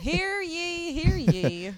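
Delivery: loud, high herald cry; town crier. Pretend. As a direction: call it out to the square. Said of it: "play a trumpet".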